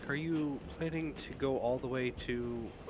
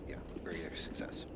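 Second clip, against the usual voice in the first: whisper; 5 semitones lower, 8 dB quieter than usual. Real, not acted.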